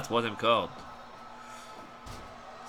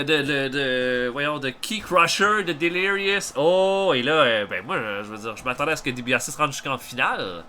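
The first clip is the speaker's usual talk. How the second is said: usual speaking voice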